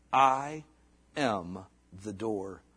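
"I", pause, "am", pause, "the door" spaced apart; slow, separated words. Acted. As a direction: lay each word down slowly with pauses. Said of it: "slow emphatic I am".

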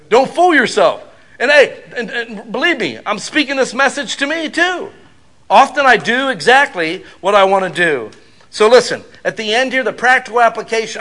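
loud, high preaching; preaching at full force. Real, not acted. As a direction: drive it home to the congregation. Preaching climax.

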